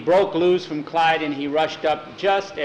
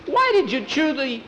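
put-on much higher boyish voice; boy's dismayed question; 13 semitones higher, 4 dB louder than usual. Boy to his returning dog, high and dismayed. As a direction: ask it high, reproaching the dog.

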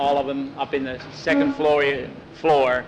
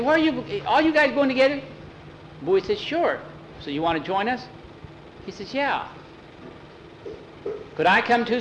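put-on high boy voices; 7 semitones higher than usual. Boys voiced high in story.

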